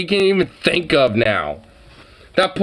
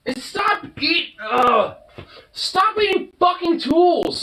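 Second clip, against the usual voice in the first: high, sharp scolding, slower; real irritation at the dog, pitched up; getting pissed; 13 semitones higher, slower than usual. Real, not acted. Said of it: "I'm actually getting pissed about that".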